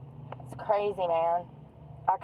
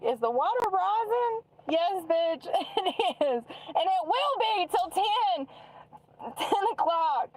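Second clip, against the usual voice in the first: higher put-on voices, laughing through the answer; mocking phone voices; 11 semitones higher, slower than usual. Retelling the phone call about rising water in a mocking high voice. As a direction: play both callers, the other one cackling back. Voicing both phone callers.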